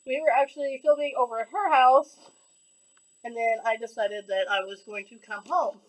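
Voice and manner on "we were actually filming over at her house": normal talking voice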